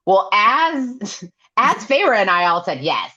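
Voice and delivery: high, laughing put-on voice; laughing Cassian quote. Cassian dodging the question, high and laughing. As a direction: giggle through it as Cassian. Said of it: "'Cause he says".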